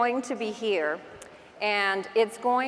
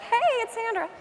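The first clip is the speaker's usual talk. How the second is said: much higher, chirpy camera greeting; bright high greeting; 12 semitones higher than usual. Retold or imitated. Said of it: "fourteen different takes".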